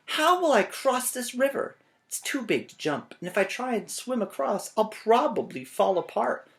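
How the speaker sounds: high, fretting question to himself; worried cheese man. Acted. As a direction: wonder aloud how to cross. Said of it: "said the stinky cheese man".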